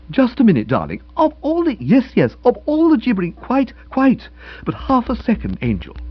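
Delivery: haut et fort, pressé, pour couper la parole; frantic interrupting. Acted. Boko frantically heads off Nobby.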